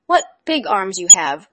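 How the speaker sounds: put-on astonished girl; amazed little girl. A narrator's reading. Girl asks grandmother.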